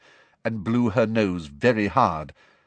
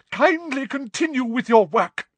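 high, tightly indignant order; overwrought command; 3 dB louder than usual. A narrator's reading. Professor sends the class back to work, indignant. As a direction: order them back to work, still upset.

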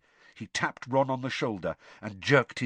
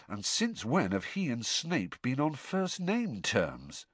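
slowly; 4 semitones higher, 5 dB quieter than usual. Acted. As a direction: puzzle it out slowly. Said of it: "said Harry slowly".

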